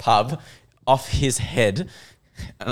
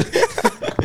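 high, loud, talking through laughter; real breathless amusement; laughing; 7 semitones higher, 4 dB louder than usual. Real, not acted. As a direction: tell it while cracking up. Cracking up at his own story.

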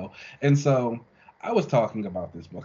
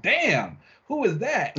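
a mock-stunned "Damn" leaping far higher in pitch; awed surprise; 12 semitones higher, 3 dB louder, slower than usual. The team seeing Casper's new form, hammed-up amazement. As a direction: gasp it out like you can't believe your eyes. Characters awed by the energy being.

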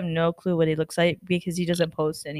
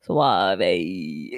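suave voice; 14 semitones lower than usual. Retold or imitated. Friend mocking how Jay Word talks, lightly suave. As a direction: play a smooth suave guy. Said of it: "all suave".

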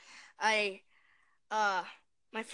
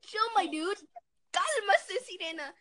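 high-pitched chill out; 7 semitones higher than usual. Retold or imitated. Retold telling boyfriend to calm.

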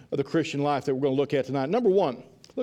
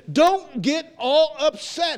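slow plea; 10 semitones higher, 4 dB louder, much slower than usual. Acted. Preacher voicing God's reassurance.

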